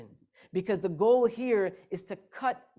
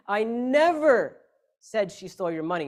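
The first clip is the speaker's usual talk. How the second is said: long emphatic "never", the rest at usual level; stress on NEVER; 5 dB louder than usual. Shown as a demonstration. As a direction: hit "never" hard, then pause before the rest. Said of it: "depending on which word you emphasize".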